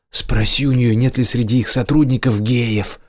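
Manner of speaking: plain reading voice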